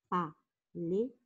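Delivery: "Palais" in two separate beats, pa-lais; split into syllables. Shown as a demonstration. Teacher counting syllables for pupils, "Palais" split as pa-lais. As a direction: sound out "Palais", pausing between pa and lais. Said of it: "deux syllabes".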